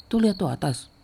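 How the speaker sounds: high, urgent pointing-out; retold insistent order. Diti points upstairs at the seniors, high insistent order.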